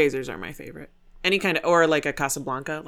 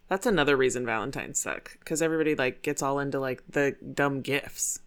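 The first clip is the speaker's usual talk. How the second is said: normal talking voice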